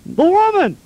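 Adam pointing at the woman, high mock whine; whiny Adam excuse. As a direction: squeal the blame, hammed-up. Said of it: "And he goes".

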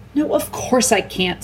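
sharp, impatient snap, a touch slow; snapped. Acted. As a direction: cut her sister off curtly. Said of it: "snapped Susan".

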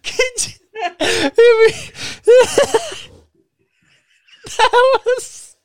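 helpless high-pitched laughing fit; laughing to tears. Real, not acted. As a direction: try to talk through uncontrollable laughter, words breaking up.

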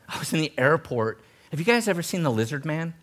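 ordinary talk

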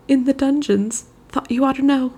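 high, thin faltering voice; faint. A narrator's reading. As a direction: say it as if about to pass out.

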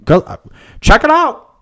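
high, loud mock promo sign-off; podcast ad sign-off. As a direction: punch it out like a hammed-up ad tag. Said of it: "And then they dive back in".

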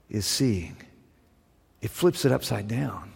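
hushed emphasis. Real, not acted. Sermon's hushed climax.

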